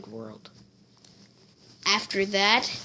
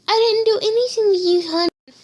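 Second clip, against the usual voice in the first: much higher, louder and fast, sugary denial; fake-sweet mom; 11 semitones higher, 5 dB louder, faster than usual. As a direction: play innocent mother too sweetly.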